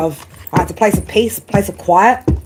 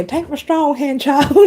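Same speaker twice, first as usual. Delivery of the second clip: mock wise elder; 7 semitones higher, slower than usual. Mock grand spiritual voice.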